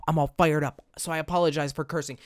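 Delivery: ordinary delivery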